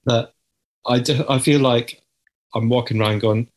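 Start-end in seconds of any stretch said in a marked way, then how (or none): none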